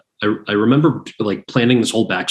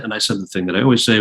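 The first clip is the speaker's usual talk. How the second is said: normal talking voice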